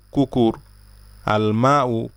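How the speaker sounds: ordinary delivery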